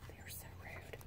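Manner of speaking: hushed whispered comment. Real, not acted. Teacher whispers an aside to her pre-K class. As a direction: whisper it like a secret.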